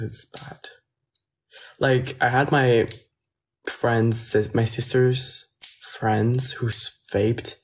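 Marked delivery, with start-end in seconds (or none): none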